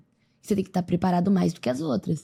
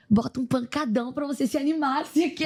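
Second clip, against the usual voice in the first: higher, lightly mimicking an upbeat sister; hyped-up sister; 6 semitones higher than usual. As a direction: play the pumped-up sister cheering her on. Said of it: "Ela tá astral lá em cima".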